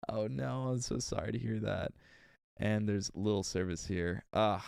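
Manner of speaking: usual speaking voice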